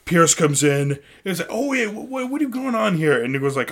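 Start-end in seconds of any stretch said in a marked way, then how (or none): 1.5-3.2 high squeaky what is going on; mock surprise. Pierce walking in on the scene, high mock surprise. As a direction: gasp it out high and fast.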